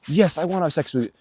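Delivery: high, hammed-up robotic agreement; mock stiff reply. Vlogger mocks a scripted consent reply, high and stiff. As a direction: play a wooden formal yes. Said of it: "nobody's out here fucking".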